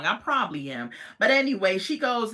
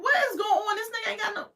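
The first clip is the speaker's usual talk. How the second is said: high, disbelieving exclamation; a high, amazed outburst about the missing teeth; incredulous outburst; much faster than usual. Real, not acted. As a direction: shriek it in amused shock.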